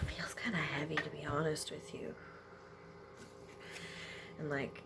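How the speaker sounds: whisper. Real, not acted. Emotional tarot reading, whispering.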